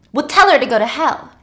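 dismissive anger. Acted. Virgil dismissing secretary.

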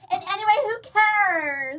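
put-on very high, slow valley girl drawl. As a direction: toss it off like a ditzy valley girl. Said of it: "talking like a valley girl".

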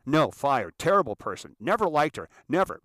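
loud, punched staccato verdict; imitated emphatic rejection; curt dismissal. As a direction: shoot her down word by word. Acts out producer's curt dismissal.

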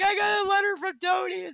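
very high, hammed-up upset wail; mock your own hot-mess meltdown in a high voice; retold freak-out. Telling Katie about the letter from Tony, mock freak-out. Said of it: "I'm freaking out. I'm a hot mess. I'm crying".

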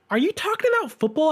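a hint of a baffled partner; quick, mildly incredulous question; imitating fiancee. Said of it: "And she goes".

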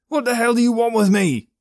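high, loud panicked scream; terrified scream. Acted. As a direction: scream in terror. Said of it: "he screamed".

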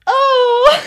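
loud, high, bubbly "oh"; hammed-up chirpy surprise. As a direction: put on sudden bubbly cheer. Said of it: "so, so bubbly".

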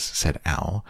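plain reading voice

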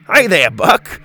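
cheery scientist. Acted. Doctor Rex greets cheerfully.

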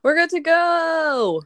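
high, loud, slow cheer; cheerful echo. Acted. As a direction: sing it out happily. Echoing the sister cheerfully.

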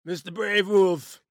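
slow, loud, gruff dwarf voice; Asgardian dwarf voice. Pretend. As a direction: ask it like a booming Asgardian dwarf. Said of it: "ask him a question in your Asgardian dwarf voice".

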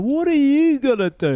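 high, loud mocking taunt from a giant. Opponent taunting him in the ruck, high mocking taunt. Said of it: "he's just going".

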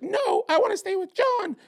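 very high-pitched imitation of crying; mock crying. Guest imitating girlfriend crying to police, mock wailing. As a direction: put on an exaggerated high wail. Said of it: "And she's crying. She's like".

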